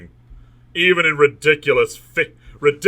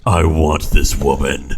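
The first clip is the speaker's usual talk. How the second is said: very low, forceful growl of desire; lustful hero; 11 semitones lower, 4 dB louder than usual. A narrator's reading. Hero wanting this woman, deep lustful voice. Narrator plays hero's lustful thought.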